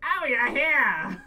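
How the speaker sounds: high, loud, slowed surprised cry; surprised character. Acted. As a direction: sound caught off guard.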